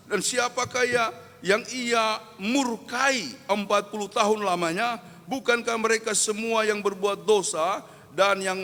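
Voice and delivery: loud, high scripture proclamation; full-force preaching. Acted. Preacher reads the verse of God's wrath, full-force preaching.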